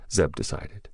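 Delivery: ordinary delivery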